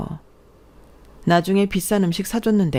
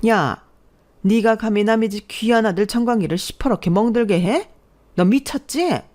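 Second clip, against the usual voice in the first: higher-pitched indignant accusation; scandalized scolding that climbs at the end; outraged mother-in-law; 5 semitones higher than usual. Acted. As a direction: confront her as a boy's furious mother, moderately acted.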